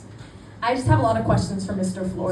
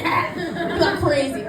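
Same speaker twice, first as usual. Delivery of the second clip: laughing at herself; 7 semitones higher, much slower than usual. Real, not acted.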